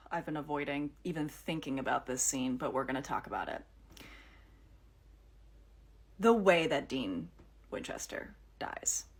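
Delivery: barely able to say it; a grieving fan forcing herself to talk about Dean's death; hushed dread. Real, not acted.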